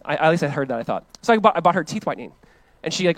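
normal talking voice

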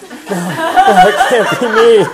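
loud, high, laughing protest; laughing denial. Retold or imitated.

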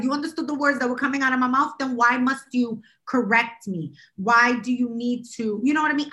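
usual speaking voice

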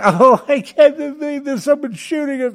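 very high, whiny falsetto of a stunned coward; mock marveling wimp. Mocking the hikers in the film, high whiny squeal. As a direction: squeal it like a helpless weakling. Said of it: "They're sitting there going".

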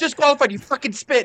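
high, loud angry ruling; mock referee shout. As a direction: yell the disqualification. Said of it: "they're all".

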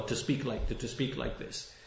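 to, to speak like this, dropped almost to a breath, no voice; near whisper. Real, not acted. Preacher trailing off to his listeners, almost whispering. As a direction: let it fall away to a hush. Preacher repeating the condemnation softly.

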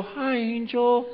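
soft, drawn-out little hello with a laugh; mock casual greeting. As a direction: greet the angel sweetly and slowly, tongue in cheek.

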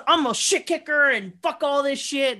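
imitating a belligerent guy, hammed-up. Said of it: "this guy says".

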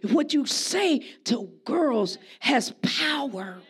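normal talking voice

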